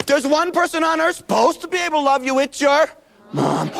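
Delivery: put-on high, gasping, alarmed child; panicky kid voice. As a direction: gasp it out in mock panic. Acting the kid's panicking horse.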